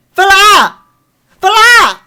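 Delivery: high, very loud furious yell; furious shout. Real, not acted. Fan yelling at the TV episode, full fury. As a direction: scream it twice at the screen. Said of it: "I'm so angry".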